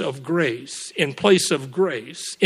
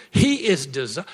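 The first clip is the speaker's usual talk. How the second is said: ordinary talk, nothing marked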